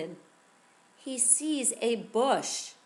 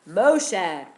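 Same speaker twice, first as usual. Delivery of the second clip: ordinary delivery, nothing marked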